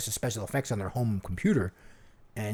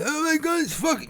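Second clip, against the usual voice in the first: high-pitched mock [name]; a quick, high take on [name]; lightly voiced rock star [name]; [name] impression; 14 semitones higher than usual. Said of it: "he'll be like".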